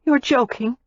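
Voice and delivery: high, weak protest. A narrator's reading. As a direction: feeble disbelief. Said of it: "she said weakly".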